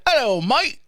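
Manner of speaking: a touch of a Cockney greeting, T left out; mock British accent. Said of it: "everything's like".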